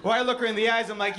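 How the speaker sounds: normal talking voice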